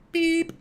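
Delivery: high, loud, steady mouth-made beep; imitated tape beep. Host imitating German lessons on tape, high beep. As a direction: mimic the tone on a language tape. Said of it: "they do it on a tape".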